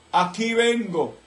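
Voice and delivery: slow, lightly voiced divine call; a hint of a solemn heavenly voice; voice of God. The voice of God telling him in prison, slow and solemn. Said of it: "oí la voz de nuevo aquí".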